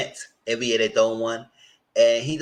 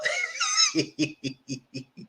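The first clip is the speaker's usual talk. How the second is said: a high squealing burst of laughter; shrieking laugh; 4 dB quieter than usual. Real, not acted. Host cracking up about the untouched cold food. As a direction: let out a screechy cackle.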